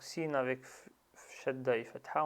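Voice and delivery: ordinary delivery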